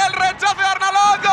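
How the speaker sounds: rising live play-by-play to a goal call; goal commentary. Real, not acted. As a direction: call the build-up then shout the goal.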